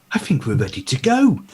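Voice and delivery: awkward. Acted.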